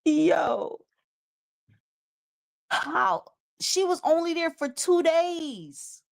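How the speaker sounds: high, stunned yo, how, she was only there; can't believe it, voice shooting up; shocked disbelief. Real, not acted. Stunned at the guest's two-day wreckage, high disbelief.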